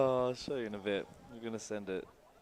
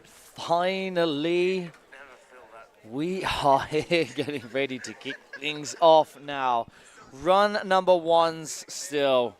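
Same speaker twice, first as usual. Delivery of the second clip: drawn-out excited commentary, louder; sports commentator; 3 semitones higher, 10 dB louder, slower than usual. Acted. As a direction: announce the race like live TV. Mock race commentary.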